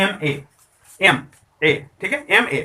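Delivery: एम ए broken apart, एम then ए, slowly; spelled-out sound demo. Shown as a demonstration. Teacher slowly sounding out एम ए to students. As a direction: sound out एम ए letter by letter for the class. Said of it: "तो sound इस तरह से आता है".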